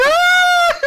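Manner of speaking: very high, loud mock shriek; playful piercing scream; imitated kids' scream. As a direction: shriek like a grabbed child, then laugh. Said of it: "And they are screaming".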